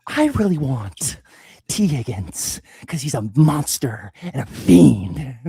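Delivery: slow, creepy whispered villain; Joker whisper. Acted. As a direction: hiss the draft pick like a madman. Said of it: "who should be our third round pick as the Joker".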